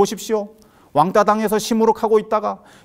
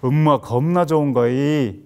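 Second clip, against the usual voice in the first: slow, drawn-out Jeolla dialect; lower, leisurely Jeolla lilt; 5 semitones lower, slower than usual. Shown as a demonstration. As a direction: try on a Jeolla accent, stretching the words. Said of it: "전라도 사투리로".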